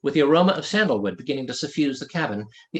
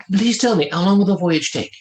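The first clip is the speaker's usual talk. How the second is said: louder, higher princely voice; lightly voiced French prince, curious; a hint of the French prince; 5 semitones higher, 7 dB louder than usual. Prince asking the weathermaster about the voyage, lightly voiced.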